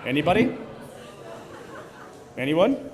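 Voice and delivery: ordinary talk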